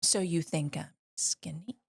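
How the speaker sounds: hushed whisper. Acted. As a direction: whisper it aside. Whispered joke aside.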